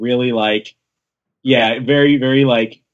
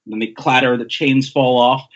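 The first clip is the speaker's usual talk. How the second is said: ordinary delivery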